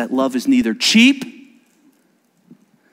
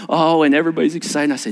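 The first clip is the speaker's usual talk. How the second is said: ordinary talk, nothing marked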